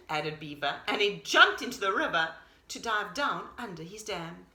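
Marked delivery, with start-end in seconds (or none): none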